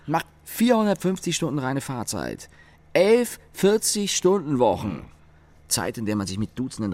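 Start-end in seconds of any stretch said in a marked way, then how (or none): 0.1-5.1 louder, higher, building grievance; heated complaining, pitched high; indignant rant. Acted. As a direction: pile up the numbers with rising outrage. Colleague ranting about commuting.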